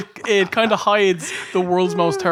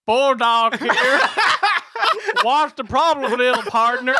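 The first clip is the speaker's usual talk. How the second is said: mock Texas accent; 5 semitones higher than usual. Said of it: "do the accent of".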